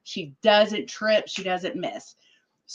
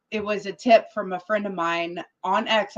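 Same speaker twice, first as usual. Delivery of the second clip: ordinary talk, nothing marked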